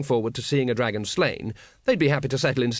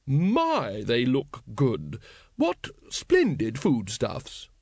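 slow, over-polite lady's praise; faint horror, politely hidden; much slower than usual. A narrator's reading. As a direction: gush politely through a trace of horror. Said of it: "faintest suggestion of horror in her voice".

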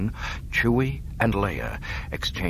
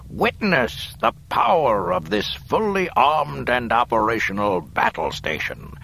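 gloating Emperor; 5 semitones higher, 4 dB louder than usual. Acted.

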